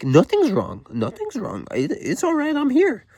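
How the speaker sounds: high, strained mock insistence; jumpy pitch leaping way up; frantic reassurance. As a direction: act out a tackled man insisting he's fine. Acting the tackled man insisting he's fine.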